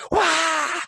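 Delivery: sudden high roar, like a startled demon; a hammed-up monster blast of a wah. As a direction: lash out with a shrieking wah. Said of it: "you're just like".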